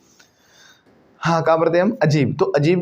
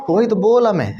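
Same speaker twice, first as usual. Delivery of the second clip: put-on insisting, Tamil accent; 6 semitones higher than usual. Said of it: "ये Tamil Nadu accent है".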